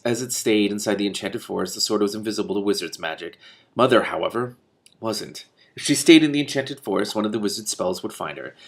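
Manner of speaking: ordinary delivery, nothing marked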